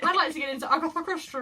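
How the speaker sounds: posh, fast and high. Pretend. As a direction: put on a plummy posh accent. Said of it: "If you say it like a posh person".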